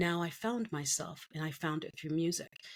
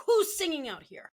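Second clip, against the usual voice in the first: calling out; 10 semitones higher, 5 dB louder than usual. Retold or imitated. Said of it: "a teacher jumps out of the room and goes".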